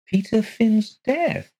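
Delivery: high, slow, startled question; surprised. Acted. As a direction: echo the words in shock. Mrs Colgate surprised.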